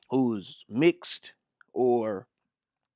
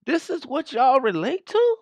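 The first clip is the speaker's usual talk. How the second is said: much higher, louder indignant question; outraged disbelief; 13 semitones higher, 5 dB louder than usual. Real, not acted. Commentator confronting his viewers, indignant disbelief. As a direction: demand it in disbelief. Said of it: "outraged rhetorical question".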